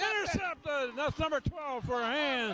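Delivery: high-pitched excited play call. Real, not acted. Announcer calls a Hand interception, excited. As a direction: announce the pick with a surge of excitement.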